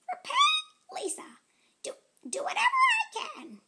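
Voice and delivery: very high, fast, laughing ant; giggly ant voice. Acted. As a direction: tease the listener as a giggling ant. Ant reads its note.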